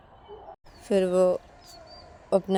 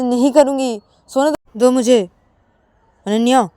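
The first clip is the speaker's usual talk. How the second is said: loud, high, exasperated order; angry refusal; 6 semitones higher, 10 dB louder than usual. Acted. Payal refuses to forgive and wants to sleep, angry. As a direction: refuse forcefully, voice raised.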